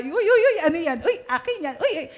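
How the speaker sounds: high, laughing squeals of delight; giggly, high-pitched mock excitement; playful excitement. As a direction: act out an eager grab, pitch leaping. Acting out joy at getting supplies.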